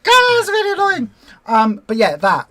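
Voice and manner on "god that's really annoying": high, loud, fast annoyed cry; mock annoyed outburst; exasperated. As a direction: groan it out, fully irritated. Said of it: "It's like".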